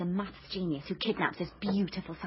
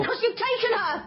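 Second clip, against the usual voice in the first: accusing shout; 12 semitones higher than usual. Acted.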